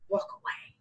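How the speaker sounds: soft, unvoiced whisper; hushed advice. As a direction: whisper it as the final word of counsel. Soft advice ending the quoted counsel.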